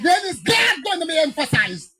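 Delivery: loud, high indignant demand; a pastor shouting his point at the conference; retold shout. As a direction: raise the voice and demand an answer. Retold argument with the president.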